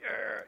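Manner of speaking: high, soft newborn wail; imitated baby cry. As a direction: mimic a crying infant without full volume. Imitates newborn crying on table.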